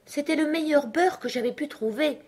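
high, meek protest; humble excuse. A narrator's reading. March Hare defends his butter, humbly. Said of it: "répondit l'autre d'un ton humble".

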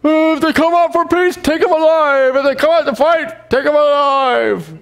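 put-on high, slurred drunk king. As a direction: play a sloppy drunk giving orders. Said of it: "Doesn't that sound like a drunk guy?".

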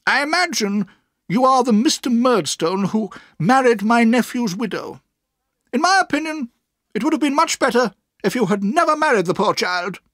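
high, loud, disapproving aunt; sharp. A narrator's reading. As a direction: tell him off sharply and emphatically. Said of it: "said my aunt sharply".

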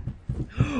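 sudden unvoiced gasp of fright; sharp gasp. Real, not acted. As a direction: gasp in shock. Monster appears.

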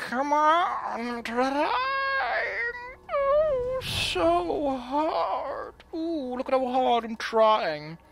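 high, whiny, fake-crying plea; mock pleading villain. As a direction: overact a villain begging for suspicion. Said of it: "I'm like".

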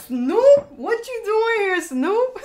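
excited surprise. Real, not acted. Surprised to see [name].

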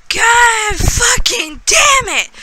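high, slow, furious yell; frustrated shout. Real, not acted. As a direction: swear it out at the broken camera. Camera failing, swears in frustration.

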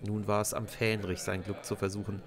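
ordinary delivery, nothing marked

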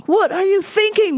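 normal talking voice

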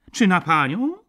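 loud, high, cheeky question; impudent. A narrator's reading. Lodger taunting the baroness in court, impudently. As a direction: ask it with brazen insolence. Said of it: "spytał zuchwale pan Maleski".

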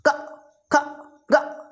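क, ख, ग each cut off abruptly; short separate jerks on क, ख, ग; clipped letters. Shown as a demonstration. Teacher drilling half letters, क, ख, ग clipped short. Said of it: "झटके से बोलना है".

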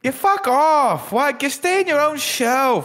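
high, loud, slow cranky yell; hammed-up squeaky grump; Gary impression. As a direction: squeal at intruders to leave. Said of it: "Gary goes".

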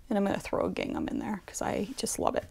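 ordinary talk

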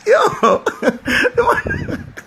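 real helpless high-pitched laughing; crying with laughter. Real, not acted. Teller cracking up at his own story, crying with laughter. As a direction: squeeze words out through laughter. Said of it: "peeps, I'm crying".

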